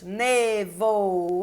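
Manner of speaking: nevoeiro very slow, ne-vo-ei-ro; syllable by syllable. Shown as a demonstration. Teacher showing four syllables, nevoeiro very slowly syllable by syllable. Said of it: "Quatro sílabas".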